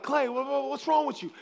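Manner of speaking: high, stammering, urgent question; imitated alarmed daughter. Daughter asking dad what is wrong, high stammered voice. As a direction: stumble over it in mock alarm.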